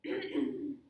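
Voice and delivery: loud throat clear with a rising tone; throat clearing with intonation. Shown as a demonstration. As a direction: clear the throat and slide the pitch up. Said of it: "I'll first model for you".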